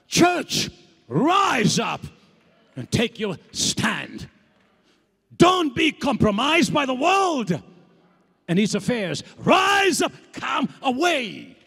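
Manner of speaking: loud, rising exhortation; rally the church to stand; preaching at full force. Real, not acted. Preacher urging the congregation to rise, full force.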